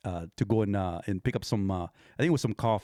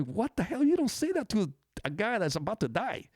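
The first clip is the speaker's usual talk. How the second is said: high-pitched, incredulous scolding without shouting; mock indignation; 10 semitones higher than usual. Bystander to tactless nurse over injured guy, mock indignation. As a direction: scold in appalled disbelief, pitched way up.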